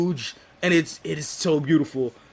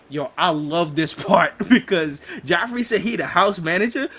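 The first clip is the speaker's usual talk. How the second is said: ordinary talk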